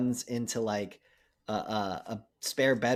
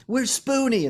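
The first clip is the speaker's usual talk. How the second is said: calling out; 12 semitones higher, 6 dB louder than usual. Retold or imitated. Calling for missing friend.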